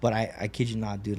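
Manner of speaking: ordinary talk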